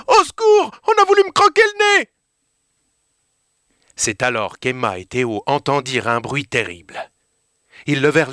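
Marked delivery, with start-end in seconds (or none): 0.1-2.1 much higher, louder, rushed scream for help; startled yelp; panicked cry. Acted. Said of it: "Surpris, il cria". (cri de panique, très aigu, rapide)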